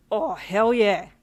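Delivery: loud, whooping cheer; excited yell. A narrator's reading. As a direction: cheer for your partner. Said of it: "he yelled with excitement".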